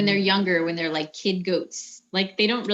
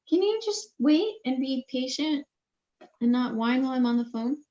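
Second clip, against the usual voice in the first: higher-pitched plea for patience; chiding aside; 6 semitones higher than usual. Real, not acted. Speaker on the phone turns to hush a whiner, high-pitched chiding. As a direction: gently scold in a raised pitch. Aside to someone whining during the call.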